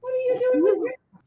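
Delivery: high, fast squeaky repeat; high mock echo. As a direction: parrot it back high and quick. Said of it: "echoes host in put-on voice".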